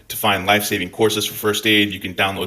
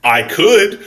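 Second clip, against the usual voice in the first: could, louder and drawn out; stress on could; 9 semitones higher, 7 dB louder than usual. Real, not acted. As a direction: lean on could. Retort to viewers.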